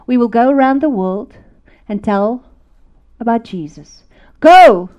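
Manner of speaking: loud, emphatic declaration building to a shout; preaching rallying cry. Retold or imitated. Reading his letter to the world, loud preaching charge. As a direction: proclaim in firm phrases, then shout the charge.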